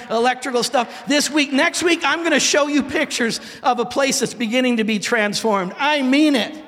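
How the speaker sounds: high, loud, building preacher's cry; full-force preaching. Acted. Pastor shouting the church vision to the congregation, full-force preaching.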